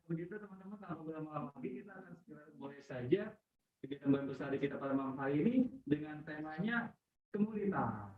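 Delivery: very faint, far from the mic; barely audible. Real, not acted. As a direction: voice too quiet to follow. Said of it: "Suaranya kecil banget". (suara kecil banget)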